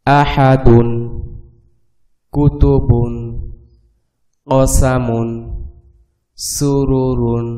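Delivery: each word drawn out, slow, with pauses; slow recitation drill. Shown as a demonstration. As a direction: recite each word whole, slowly, like a teacher. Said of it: "উচ্চারণ করে দিব, বানান না, শুধু উচ্চারণ". (ধীরে টেনে টেনে পুরো শব্দের উচ্চারণ)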